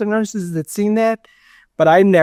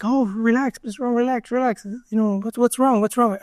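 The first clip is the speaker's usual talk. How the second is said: lightly voiced nurse, higher and softer, calming; soothing nurse; 6 semitones higher, 4 dB quieter than usual. Nurse calming a patient whose heart rate spikes, higher and softer. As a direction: gently settle a patient down, a touch mock-soothing.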